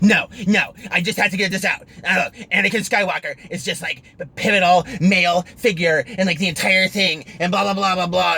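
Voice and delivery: mimicked tirade. Driver mimics Star Wars fan arguing in his car, mock tirade. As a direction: rant insistently, imitating her pushing each point. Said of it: "she starts going into this tirade".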